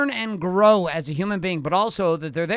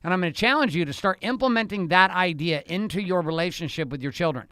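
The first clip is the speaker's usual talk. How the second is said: normal talking voice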